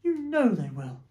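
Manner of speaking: high, loud acted lament; sobbed plea. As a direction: wail it as if sobbing. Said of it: "sobbed Missus Fox".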